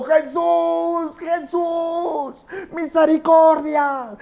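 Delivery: high, wailing, drawn-out cry; persistent shouting. Acted. Blind beggar still begging for mercy, high wailing shout. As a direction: keep calling out, stretching every word. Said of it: "él seguía gritando".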